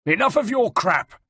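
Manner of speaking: high-pitched angry retort; snarled. A narrator's reading. Captive general answers his gloating enemy, snarling. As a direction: cut him off with a snarl. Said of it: "snarled Bartolomeo".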